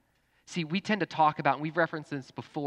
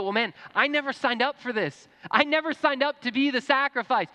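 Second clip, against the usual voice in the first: high, loud complaint; mock inner protest; 12 semitones higher, 6 dB louder than usual. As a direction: voice an exasperated believer protesting. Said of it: "language where we all internally feel".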